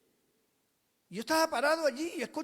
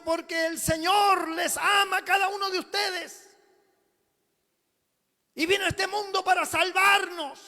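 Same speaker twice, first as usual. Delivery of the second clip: loud preaching shout; 6 semitones higher, 9 dB louder than usual. Retold or imitated. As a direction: preach out loud to the whole bus. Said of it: "una voz salió fuerte".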